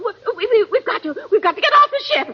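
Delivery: stammering, tearful panic rising to pleading; breaking down, words tumbling over each other; panicked. Acted. Frightened passenger begging the steward to let them leave, panicked.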